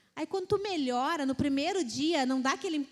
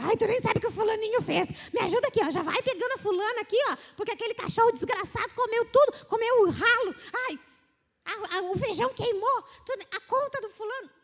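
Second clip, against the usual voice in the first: high, loud complaining rant, rushed; wife greeting husband at the door with complaints, higher and louder; nagging wife; 7 semitones higher, 5 dB louder, faster than usual. Retold or imitated. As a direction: unload every problem on the husband. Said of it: "só enxerga a mulher reclamando".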